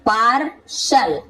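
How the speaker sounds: पार, pause, then शल, slowly; syllable by syllable. Shown as a demonstration. Teacher sounding out an example, पार and शल slowly separated. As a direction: sound out "paar ... shal" in two slow parts. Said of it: "तो इसे शल".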